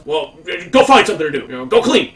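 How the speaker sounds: fast, loud retold yell; yelling supervisor. Supervisor yelling at worker to go clean, loud and fast. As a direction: yell it at him fast, like a fed-up boss. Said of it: "He just yells".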